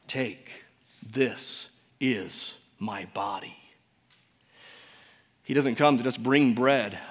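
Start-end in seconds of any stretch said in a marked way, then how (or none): 0.1-3.6 soft, slow, reverent words of Jesus; solemn hush. Real, not acted. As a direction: speak the words with quiet reverence. Quoting Jesus, hushed and slow.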